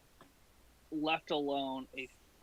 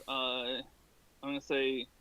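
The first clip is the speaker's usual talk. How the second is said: ordinary talk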